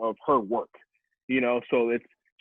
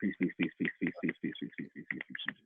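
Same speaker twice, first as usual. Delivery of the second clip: low murmur fading to a whisper; whispered fade-out; fading sign-off; 8 semitones lower, 12 dB quieter, faster than usual. Acted. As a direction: repeat it softer and softer until it vanishes. Repeated sign-off fading out after poem.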